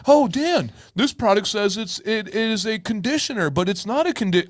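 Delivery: mock complaint. Wife mimicking her own complaints to Dan, lightly whiny. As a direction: imitate a grumbling partner. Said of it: "You will hear me complain about it".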